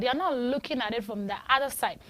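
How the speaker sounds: ordinary delivery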